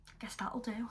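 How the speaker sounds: unvoiced whisper to herself; whispered aside. Real, not acted. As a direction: breathe it out under her breath.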